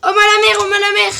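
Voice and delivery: loud, high alarmed cry; panicked exclamation. Real, not acted. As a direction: blurt it out in a panic. Panicked cry as cops arrive.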